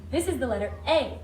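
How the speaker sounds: ordinary delivery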